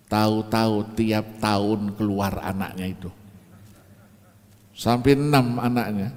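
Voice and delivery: ordinary talk, nothing marked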